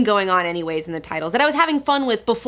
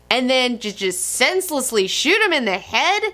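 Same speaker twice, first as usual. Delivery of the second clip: ordinary talk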